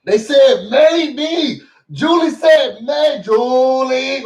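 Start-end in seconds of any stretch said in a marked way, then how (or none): 2.8-4.2 drawn-out call. Acted. Calling Julie's name dramatically.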